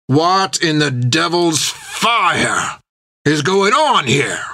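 slow, high-pitched captain's shout; Creech shouting. A narrator's reading. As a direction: bellow it slowly, as a captain to his crew. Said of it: "he shouted".

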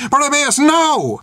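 long, high desperate cry; anguished, drawn-out scream at contained volume; bellow. A narrator's reading. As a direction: draw the protest out high and slow. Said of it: "she bellowed".

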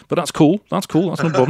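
loud jokey reassurance; teasing. Real, not acted. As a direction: rib him about the long answer. Teasing guest about long answer.

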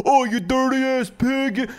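a drawn-out mock yell in a put-on voice; imitating an angry shopper. Mimicking the guy yelling at the woman, slow mock yell. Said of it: "The guy yelling at the woman saying".

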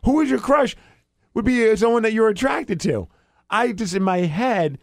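rising excitement as he explains himself; excited retelling. Real, not acted.

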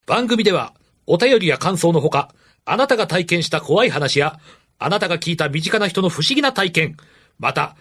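high, bright announcer voice; announcer read. Acted. Reads call for submissions.

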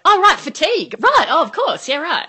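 hammed-up penny-drop moment; sudden realisation. Retelling the moment someone corrected her "fatigue". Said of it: "I went".

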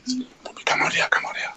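hushed whisper calling someone over. Real, not acted. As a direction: whisper so nobody wakes. Sneaking into house to wake son.

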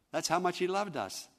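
usual speaking voice

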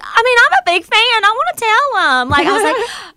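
excited fangirl. Retold or imitated. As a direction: gush like a nervous fan. Retold fangirl self.

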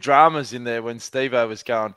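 ordinary delivery

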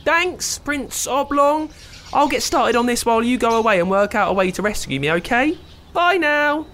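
very high sugary princess voice; high and sweet, hurrying him away; sweet brush-off. Acted. Rapunzel dismissing the prince.

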